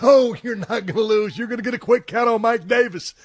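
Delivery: mock booker, confident and upbeat; imitating the booker. Imitating the booker reassuring him.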